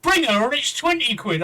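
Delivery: a badly faked Welsh accent, upbeat; attempted Welsh accent. Teller imitates a Welsh woman's cheeky offer, weak Welsh accent. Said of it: "I can't do a Welsh accent".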